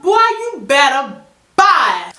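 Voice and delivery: high, loud, slow drawn-out brush-off; exasperated telling-off of a pest caller; fed-up dismissal. Retold or imitated. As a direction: stretch it out to shut him down.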